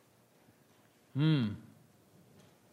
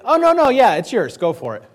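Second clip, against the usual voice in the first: mocking higher, louder yes-man act; "Go for it" dripping with mock cheer; sarcastic agreement; 4 semitones higher, 6 dB louder than usual. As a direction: sell the sarcasm, wink at him. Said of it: "Micaiah goes in basically".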